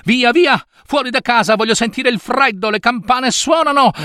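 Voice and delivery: high-pitched, eager and overjoyed; exultant. Acted. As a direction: rush out of the house crying with joy. Scrooge esce di casa esultante. (esultante, a voce alta e acuta)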